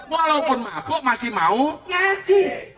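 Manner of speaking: ordinary talk, nothing marked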